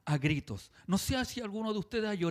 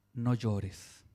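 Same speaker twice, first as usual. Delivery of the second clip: compassionate; 9 semitones lower than usual. Retold or imitated. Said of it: "se compadeció de ella".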